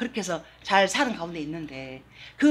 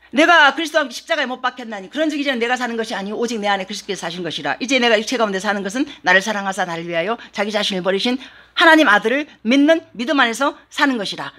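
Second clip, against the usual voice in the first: quick scripture reading; 3 semitones higher, 7 dB louder than usual. Real, not acted. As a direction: rattle off the verse at a fast clip. Reads Galatians 2:20 quickly. (갈라디아서 구절을 빠르게 읽어 내려가는 설교자)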